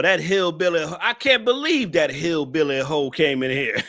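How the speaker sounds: normal talking voice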